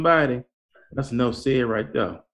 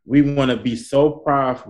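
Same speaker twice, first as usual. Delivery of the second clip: normal talking voice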